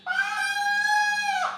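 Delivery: high, loud cry for attention; a woman crying out to her master, full shout; desperate shout. Acted. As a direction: scream it out to be heard.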